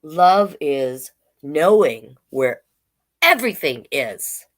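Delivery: slow, sing-song picture-book read; reading to children. Acted. Drag queen reading a picture book to kids, slow and sing-song. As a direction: read each page slowly to the little ones.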